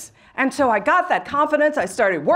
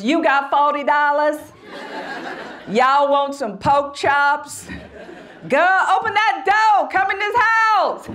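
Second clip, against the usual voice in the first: backwoods Southern dialect; 2 semitones higher than usual. Shown as a demonstration. Speaker showing how her family spoke back then, backwoods Southern dialect. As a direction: holler it in a thick backwoods Southern accent. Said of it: "Everybody spoke like that".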